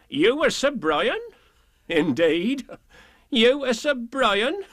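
high, slow ironic question; mocking villagers. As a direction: tease him with fake awe.

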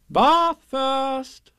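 high, loud motherly singsong; drawn-out cheery call; cheerful. Acted. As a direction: sing it out merrily. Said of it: "said Kanga in a cheerful voice".

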